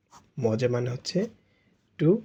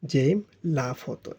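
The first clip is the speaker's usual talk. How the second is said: slow model sentence; slower than usual. Shown as a demonstration. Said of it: "এজন্য এটার পূর্বে লা বসবে".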